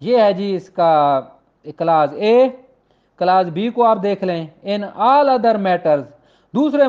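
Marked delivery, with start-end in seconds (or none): none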